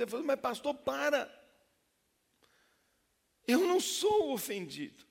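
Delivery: high, loud anguished pleading; desperate confession. Retold or imitated. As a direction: cry out like a broken man. Man's desperate confession, cries out.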